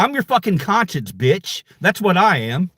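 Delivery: normal talking voice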